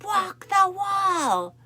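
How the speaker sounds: high, loud and drawn out order; slow commanding shout. Retold or imitated. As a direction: stretch the command out and push. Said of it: "And he's like".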